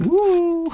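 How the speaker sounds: loud, high cheer of delight; excited whoop. Real, not acted. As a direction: let out a thrilled woo. Entering cave walkthrough, excited whoop.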